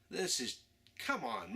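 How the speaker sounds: ordinary delivery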